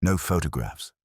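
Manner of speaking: plain reading voice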